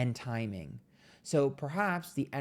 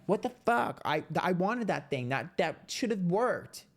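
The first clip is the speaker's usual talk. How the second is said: mock temper tantrum; 6 semitones higher than usual. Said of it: "a little temper tantrum-y".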